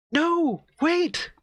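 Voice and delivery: high-pitched desperate cry; frightened woman's voice. Acted. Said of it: "it's a woman's voice right now".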